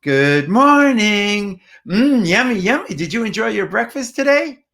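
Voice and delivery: put-on high, swooping baby-talk lilt; sing-songy parentese. Shown as a demonstration. Parent to young child at breakfast, sing-songy parentese. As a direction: coo at a toddler with big pitch changes. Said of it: "which is a sing-songy voice".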